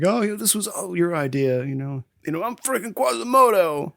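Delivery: lightly voiced whining Coogan. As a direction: grumble like a miserable costumed man. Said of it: "Coogan's like".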